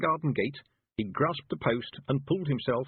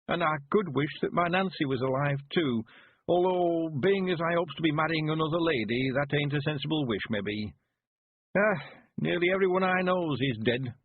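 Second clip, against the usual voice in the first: put-on high old countryman's voice, a touch wistful; an old man thinking of the dead; lightly voiced wistful old villager; old Mister Weevil; 7 semitones higher than usual. A narrator's reading.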